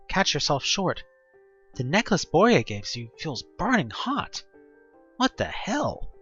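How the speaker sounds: plain reading voice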